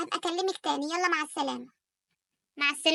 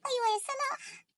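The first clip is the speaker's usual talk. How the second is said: high, tearful wife breaking the news; upset wife telling her husband she's pregnant with another girl, near tears; 3 semitones higher, 3 dB louder than usual. Acted. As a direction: hold back tears while telling him. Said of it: "مالك بتعيطي ليه".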